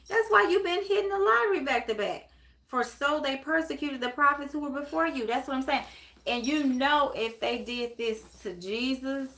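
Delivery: fired-up preacher, surging on key phrases; impassioned preaching. Real, not acted. Preacher telling a follower she is blessed, fervent and emphatic. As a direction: preach the blessing home with rising fervor.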